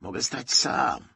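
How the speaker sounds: tense, breathy refusal of help; hissed insistence. A narrator's reading. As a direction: push the words out stubbornly. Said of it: "wysyczał Bednarczyk".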